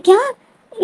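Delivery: high, shocked what; a startled क्या? squeaked high. Retold or imitated. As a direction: jolt up high in disbelief. Wing shocked by Lanzen's words.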